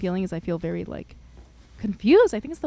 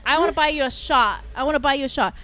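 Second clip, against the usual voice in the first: put-on loud, raised, repetitive drunken insistence; insistent drunk woman; 5 semitones higher, 9 dB louder, faster than usual. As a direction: imitate a tipsy woman laying down the law.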